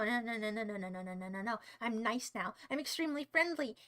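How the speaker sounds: rapid muttered no-no-no, low and soft; calming himself down. Pretend. Streamer catching himself mid-threat, hushed self-calming. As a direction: drop low and talk yourself back down.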